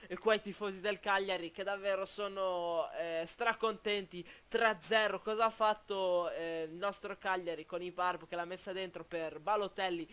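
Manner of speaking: ordinary talk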